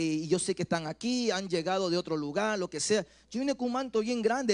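ordinary talk

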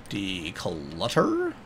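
very slow, low DECLUTTER; drawn-out word. Pretend. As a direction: stretch out every syllable of DECLUTTER, quietly. Same word repeated, drawn out.

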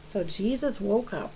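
ordinary delivery, nothing marked

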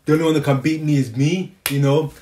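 normal talking voice

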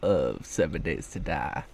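put-on silly cowboy accent. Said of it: "I'm doing the silly accent".

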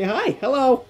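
high, rising hello; cheery mock greeting. Player voicing an enemy coming over to say hi, cheery. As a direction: call out a sweetly high hi. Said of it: "coming over to say".

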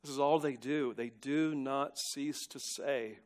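ordinary talk

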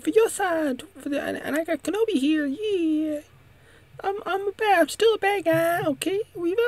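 very high, squeaky falsetto pleading; frantic double agent. Acted. Double agent pleads with Reva, frantic falsetto. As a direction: babble excuses in a silly high-pitched voice.

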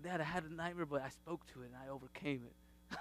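put-on softly, as the little boy telling his dad; hushed child's report, understated; son's quiet report. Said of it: "He's like".

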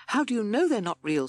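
timid toad. A narrator's reading. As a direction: question it nervously, pitched high. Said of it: "said timid Toad".

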